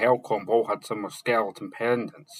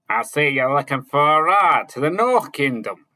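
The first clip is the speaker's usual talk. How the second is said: louder, higher pirate greeting; exclaimed with a smile; 5 semitones higher, 8 dB louder than usual. A narrator's reading. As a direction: greet the traveller boldly and loud. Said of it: "exclaimed with a smile".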